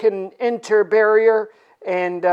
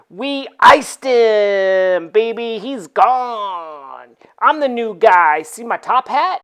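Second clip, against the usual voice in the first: cocky drawl; slower than usual. Retold or imitated. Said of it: "Iceman's like".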